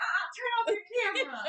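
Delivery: put-on high, laughing voice of the embarrassed boy; laughing mock plea. Friend voices the boy in the video, high and laughing. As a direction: squeal it through laughter. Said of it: "He's like".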